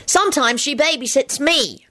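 put-on high-pitched disgusted child; disgusted Teddy. A narrator's reading. Teddy grumbling to Elizabeth about his babysitter, disgusted. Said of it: "Teddy told Elizabeth disgustedly".

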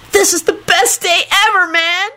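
high-pitched joyful screech; happy screech. A narrator's reading. Kenny thrilled to be trapped with his love, screeching happily. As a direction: screech it out, overjoyed. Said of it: "Kenny screeched happily".

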